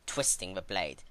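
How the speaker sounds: plain reading voice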